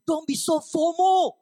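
high, loud, drawn-out scold; exhorting outburst. Real, not acted. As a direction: cry it out at the congregation, slowly. Mock-scolding exhortation to congregation.

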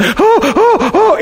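high, rhythmic contraction moans; imitated labor puffing. Wife squeezing her husband's hand in labor, imitated moaning. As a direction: puff through a mock contraction. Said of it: "she squeezed my hand".

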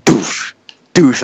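sharp vocal thud, falling off; imitated door slam. Man retelling a stall door being rammed, imitated bang. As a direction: imitate a shoulder hitting a door. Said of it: "It's like he's going".

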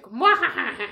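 evil mock cackle; villain laugh. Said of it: "was just like".

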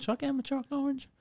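high, fast sing-song self-question; quick, high pondering in the shop; musing to himself. Retold or imitated. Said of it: "I did walk past them and went".

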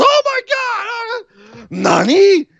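an overjoyed wrestler who cannot believe her eyes; imitating Asuka. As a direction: gasp it like she can't believe it. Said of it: "Asuka goes".

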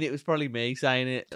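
usual speaking voice

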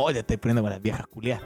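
an insult said as a term of endearment; affectionate teasing. Shown as a demonstration. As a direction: tease her fondly and quietly. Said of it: "No, no, no, es como".

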